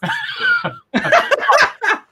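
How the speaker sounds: very high, loud laughing fit; real laughter. Real, not acted. Host reacting to co-host's botched attempt, high loud laughter. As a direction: burst into long, squealing laughter.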